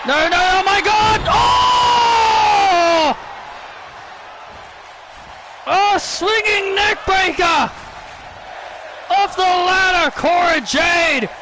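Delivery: shocked excitement. Real, not acted. Commentator calling a neckbreaker off the ladder, shocked excitement. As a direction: exclaim in shock, stretching the big calls. Reacting to big move off ladder.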